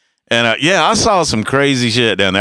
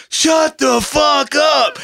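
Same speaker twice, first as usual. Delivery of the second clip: a much higher, slow, drawn-out yell from an annoyed neighbor; mock neighbor yell; 11 semitones higher, much slower than usual. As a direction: stretch it out like a fed-up neighbor. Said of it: "everybody screaming at this fucking woodpecker".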